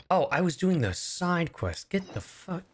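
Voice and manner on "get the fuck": normal talking voice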